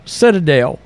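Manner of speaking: normal talking voice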